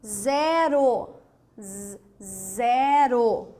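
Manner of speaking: "zero" stretched slowly, with isolated buzzing "z" between; sound of Z. Shown as a demonstration. Teacher giving a Z example, drawn out. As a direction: hold both vowels of "zero" after a clear z. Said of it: "mas se pronuncia Z".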